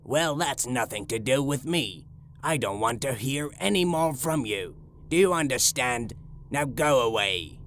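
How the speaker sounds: brisk, low-pitched dismissal; angry shopkeeper. A narrator's reading. Shopkeeper sending the little man away, angry and low-pitched. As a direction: get rid of him, fast and deep. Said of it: "closed his shop door angrily".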